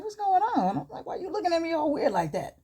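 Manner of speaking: high-pitched, indignant questioning; defensive challenge. Retold or imitated. Daughter challenges Kendrick's strange look, indignant and high.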